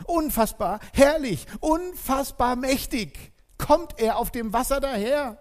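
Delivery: marvelling, exultant sermon build; rising jubilant praise. Real, not acted. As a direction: proclaim it as glorious news. Preacher extols Jesus' majesty.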